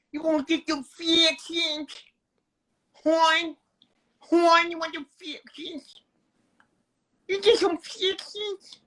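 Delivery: high, hammed-up Maryland accent. Mocking the Fixins Bar lady for the co-host, Maryland accent. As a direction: put on a high-pitched, Philly-like Maryland voice. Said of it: "that fucking accent that sound almost like Philly".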